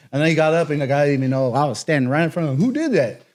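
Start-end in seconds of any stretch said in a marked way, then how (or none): 2.6-3.2 high mock exchange. Retold exchange after slap.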